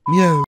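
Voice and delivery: sudden loud cat meow. Pretend. Repeated meow sound drop.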